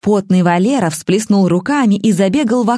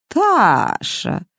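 удивлённо окликнуть Ташу; surprised; 7 semitones higher than usual. A narrator's reading. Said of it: "удивилась Розалия". (удивлённо: «Таша!»)

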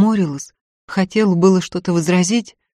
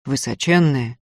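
usual speaking voice

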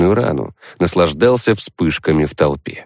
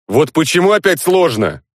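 whiny complaint, pitched up; complaining; 10 semitones higher than usual. Acted. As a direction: grumble it like a tired gamer. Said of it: "пожаловался я товарищам".

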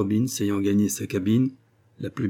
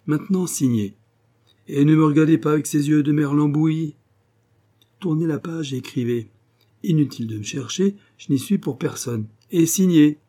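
commanding captor; 5 semitones higher, 5 dB louder than usual. Acted. Strobin orders captive to sign.

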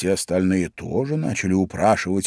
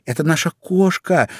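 put-on proud, high girlish voice; 8 semitones higher, 4 dB louder than usual. A narrator's reading. Alice tells Lory about her cat, proudly. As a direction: announce it high, with pride. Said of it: "с гордостью ответила Алиса".

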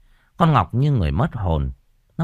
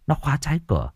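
ordinary delivery, nothing marked

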